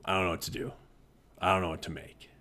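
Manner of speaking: low, quiet, at a loss; struggling student. Retold or imitated. Teacher voicing students unsure what to make, struggling and low. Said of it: "students will struggle".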